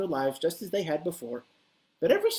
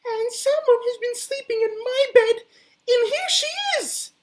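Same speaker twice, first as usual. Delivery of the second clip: very high, louder tiny voice; piping Baby Bear; 7 dB louder than usual. Acted. Baby Bear finds Goldilocks in his bed, very high piping. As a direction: pipe up like a teeny baby cub. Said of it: "piped up in his teeny tiny Baby Bear voice".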